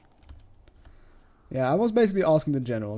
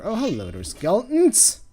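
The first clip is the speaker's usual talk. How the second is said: surprised; 7 semitones higher, 4 dB louder than usual. Real, not acted. Skeletons appear in game.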